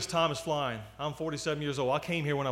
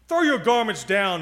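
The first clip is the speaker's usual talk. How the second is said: louder, higher, indignant command; cross; 5 semitones higher, 9 dB louder than usual. A narrator's reading. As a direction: order them crossly, as a vain donkey. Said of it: "he said crossly".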